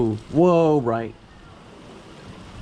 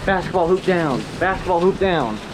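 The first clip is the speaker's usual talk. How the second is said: excited; 6 semitones higher than usual. Real, not acted. Storm flooding streets, awed reactions.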